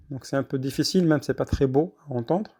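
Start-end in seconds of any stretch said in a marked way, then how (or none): none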